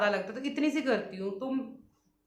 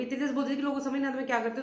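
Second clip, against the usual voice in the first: super fast talk; 4 dB quieter, much faster than usual. Shown as a demonstration. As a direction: rattle it off very fast, words tumbling. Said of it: "मैं आपको बता दूँ कितनी तेज बोलती थी".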